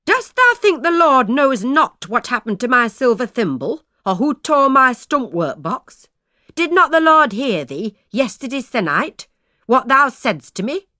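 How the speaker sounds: loud, high grievance; crying out. A narrator's reading. Aunt loudly listing grievances against her sister-in-law. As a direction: keep crying out the wrongs done to her. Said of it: "cried she".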